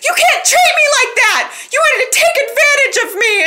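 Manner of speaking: high, loud hammed-up yell; Summer finally shouting it at the man with full force; mock outburst. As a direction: belt it out like a melodramatic outburst. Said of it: "And Summer just says".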